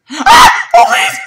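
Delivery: sudden high loud shriek; shrieking then pleading; startled scream. Real, not acted. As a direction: scream in real alarm. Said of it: "That scream".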